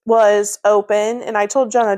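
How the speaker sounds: normal talking voice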